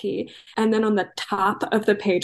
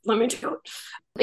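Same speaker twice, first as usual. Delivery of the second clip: imagined pleading actor; slower than usual. Retold or imitated. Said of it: "he probably was like".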